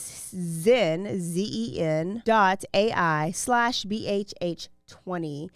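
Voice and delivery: slowly spelling out the promo code; slow spelled code. Shown as a demonstration. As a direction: spell it out clearly for listeners.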